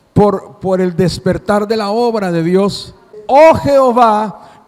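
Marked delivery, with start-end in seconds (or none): 3.3-4.6 pleading prophet. Acted. Preacher voices the prophet's plea.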